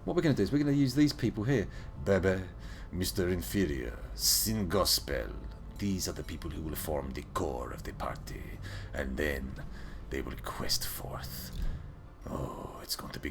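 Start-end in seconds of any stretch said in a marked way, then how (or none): none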